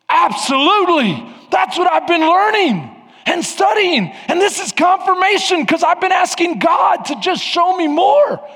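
high, loud, eager reply; thrilled young man confirming; excited agreement. Retold or imitated. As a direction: burst out in glad agreement. Marshall's excited reply, retold.

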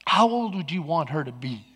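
low, soft fatherly question; gentle questioning. Retold or imitated. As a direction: quietly reason with your son. Voices God's quiet reply.

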